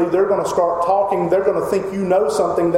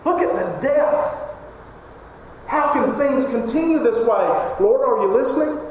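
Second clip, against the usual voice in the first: much higher, anguished crying out; 8 semitones higher than usual. Acted. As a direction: wail at God over the violence. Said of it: "he's crying out".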